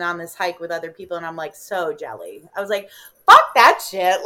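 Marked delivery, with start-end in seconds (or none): 3.3-4.2 loud defiant quote. Retold or imitated.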